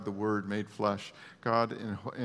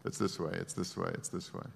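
emotionless Spock; 4 semitones lower, 6 dB quieter than usual. Retold or imitated. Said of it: "Doctor Spock who has no feelings".

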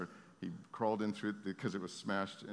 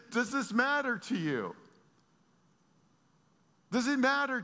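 God's plea; 6 dB louder than usual. Acted. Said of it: "God is asking us ... and saying".

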